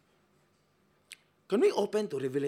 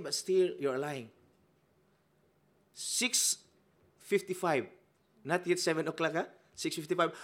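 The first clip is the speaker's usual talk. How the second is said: ordinary talk, nothing marked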